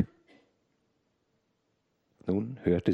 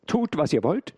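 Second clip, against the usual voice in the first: defiant reply; 8 semitones higher, 4 dB louder than usual. A narrator's reading. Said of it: "erwiderte die Martinière".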